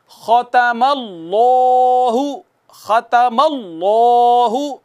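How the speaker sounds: ख़तमल्लाहु drawn out slowly with a heavy Allah; slow Quranic recitation. Shown as a demonstration. Teacher reciting the verse for students to repeat. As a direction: intone it slowly like tilawat. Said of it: "तो आइए".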